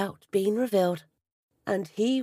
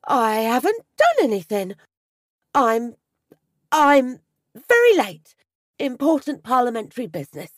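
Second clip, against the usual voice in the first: panicked stammer; 3 semitones higher, 7 dB louder than usual. A narrator's reading. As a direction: blurt it out in a panic. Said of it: "stammers Archie".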